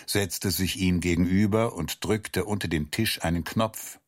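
plain reading voice

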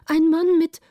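high, loud, broken-off report; alarmed report. A narrator's reading. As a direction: call it out in fright, then stick. Frightened lookout reports breathlessly. (erschrocken, hoch und laut)

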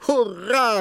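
high, loud joyful hurrah; the prince bursts out in delight; cheering shout. A narrator's reading. Prince cheering the princess's recovery, loud and high. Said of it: "rief der Prinz".

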